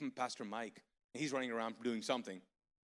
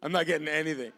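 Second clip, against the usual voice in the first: loud complaint; 3 semitones higher, 13 dB louder, faster than usual. Retold or imitated. Retold complaint about no money.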